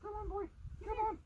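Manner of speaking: high, coaxing call to a dog. Real, not acted. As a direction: sing it up high to lure the dog over. Coaxing the dog to come over.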